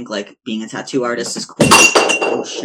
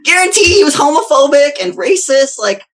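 loud, high, indignant outburst; indignant rant; 8 dB louder than usual. Real, not acted. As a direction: blast it out, indignant. Heated rant against Sailor Jerry.

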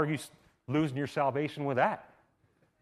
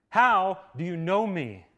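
loud, slow, wondering "How do you know me?"; slow, astonished question; 6 semitones higher, 6 dB louder, slower than usual. Shown as a demonstration. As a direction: lean on "How" with amazement. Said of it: "listen, listen to this".

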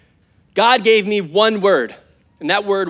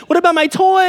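put-on high, louder whiny toddler voice; mock toddler; 10 semitones higher, 4 dB louder than usual. Toddler pleading with a parent, in a high whiny voice. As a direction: play a toddler asking for a toy.

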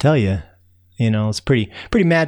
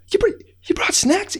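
surprised call. Acted. Narrator surprised calling to ants.

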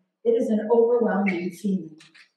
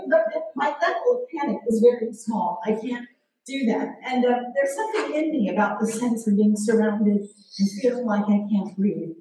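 normal talking voice

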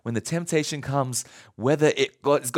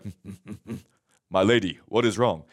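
gruff guards; 10 semitones lower, faster than usual. Retold or imitated. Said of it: "they're like".

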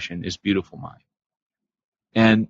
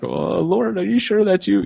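high, pleading mock question; doubtful patient; 9 semitones higher than usual. Patient questioning the voice of God, high mock disbelief. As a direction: ask the voice in disbelief. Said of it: "they're like".

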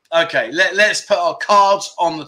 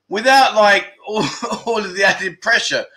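normal talking voice

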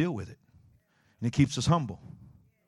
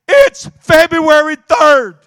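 much higher, much louder joyful cry; overjoyed; 14 semitones higher, 18 dB louder, slower than usual. Retold or imitated. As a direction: burst out with delight that the day changed. Phil overjoyed, day finally changed.

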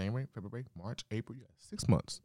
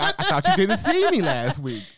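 mock protest; 13 semitones higher, 8 dB louder than usual. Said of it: "I was just like".

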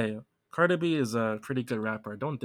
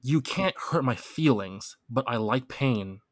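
slow, deliberate lyric reading; slower than usual. Shown as a demonstration. Quizzing a friend on a rap lyric, read slow and deliberate. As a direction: read the quiz lyric out slowly. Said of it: "the lyrics".

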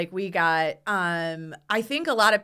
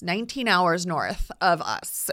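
normal talking voice

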